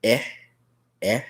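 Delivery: E said as a short eh; short vowel E, eh. Shown as a demonstration. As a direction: say E as eh, like in bed. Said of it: "short sound for E is".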